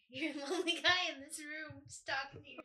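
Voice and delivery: laughing loudly through the words; laughing tease. Real, not acted. Teasing while laughing.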